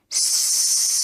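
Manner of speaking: long steady snake hiss through the teeth. A narrator's reading. The pellet hissing at the sixth formers, a long snake hiss. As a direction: hiss like a chemical snake up the chimney. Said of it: "a loud and insistent hissing noise".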